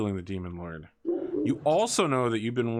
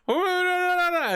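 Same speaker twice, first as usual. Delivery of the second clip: high panicked squeal; panicked chancellor; 4 dB louder than usual. Acted. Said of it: "he's like".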